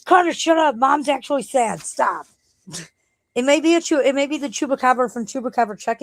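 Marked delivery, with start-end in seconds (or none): 0.1-2.3 high scolding voice; scolding friend. Retold or imitated. Friend on the phone scolding her son, high and scolding. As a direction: scold the giggling kid, pitched up. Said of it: "He's like".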